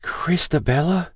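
put-on high, slow, quavering old woman. A narrator's reading. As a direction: question it in a high, wavering voice. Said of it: "in a quavering voice".

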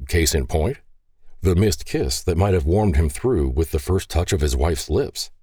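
ordinary delivery, nothing marked

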